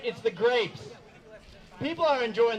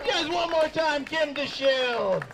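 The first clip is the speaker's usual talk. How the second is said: show host announcing; 3 semitones higher, slower than usual. Real, not acted. Host asks the crowd for applause for the comic, announcer call. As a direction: send the comic off with a stretched-out name.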